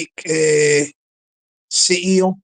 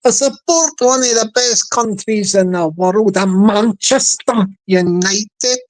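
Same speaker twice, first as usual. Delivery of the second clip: Manchester accent. Shown as a demonstration. Playing a Manchester United supporter, in Manchester accent. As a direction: draw it out as a mock Manc. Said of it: "So why they say Manchester?".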